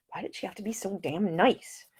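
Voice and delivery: hushed inner grumble; a private thought, whispered. A narrator's reading. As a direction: mutter it under the breath. Nick's private irritated thought.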